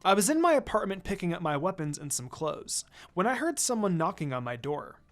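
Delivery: usual speaking voice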